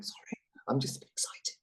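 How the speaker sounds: soft, excited whisper; whispered apology. Retold or imitated. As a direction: whisper sheepishly to your wife. Whispered apology to wife, acted.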